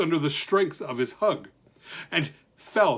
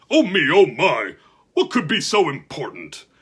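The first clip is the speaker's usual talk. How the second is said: curious giant; 5 dB louder than usual. Acted.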